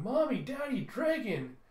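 put-on high, loud child calling parents; high-pitched, eager child's cry; excited little kid. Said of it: "still pointing up excitedly".

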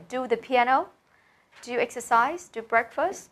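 each phrase rising at the end, as in PIANO, EXERCISE, BREAKFAST; rising quiz intonation on "Do exercise" and the other choices; questioning options. Shown as a demonstration. Offering options as questions to class.

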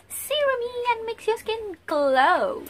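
high, slow singsong stretch; playful drawn-out hype. Acted. Vlogger hyping a serum's glow, high drawn-out singsong.

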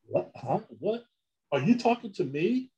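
high-pitched, disbelieving questions; puzzled double-take, voice jumping up on each question; baffled reaction. Retold or imitated. Acting out his baffled reaction to her.

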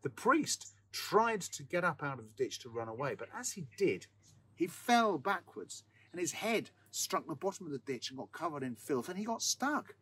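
plain reading voice

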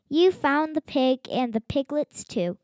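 ordinary delivery, nothing marked

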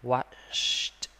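Watched ending with an aspirated t; air released at end. Shown as a demonstration. Teacher demonstrating air released on the final t. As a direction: let the air out after Watched, waʃt. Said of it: "Tiếp tục gió ra ha".

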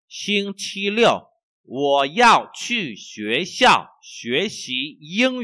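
slow, loud, carefully spaced Chinese for learners; slow model reading. Shown as a demonstration. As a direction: read each syllable slowly for the class.

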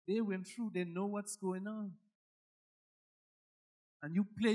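ordinary talk

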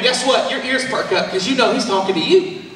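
normal talking voice

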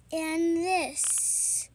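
usual speaking voice

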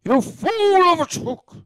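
high, slow, drawn-out wizard cry; Gandalf. Shown as a demonstration. Retrying after being told age it up, high slow wizard. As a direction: ham up an old wizard's rebuke. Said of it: "You have to age it up a little bit".

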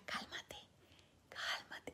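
hushed unvoiced whisper, soothing; demon's whisper. Demon whispering to the possessed man to calm down. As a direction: whisper it like a demon calming its host. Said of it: "el demonio le decía".

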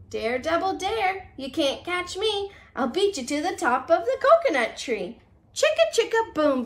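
normal talking voice

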